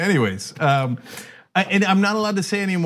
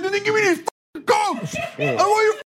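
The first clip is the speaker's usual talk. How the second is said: much higher, mock-outraged complaint; indignant outburst. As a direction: act out a high-pitched tantrum. Retold angry complaint about Cokes.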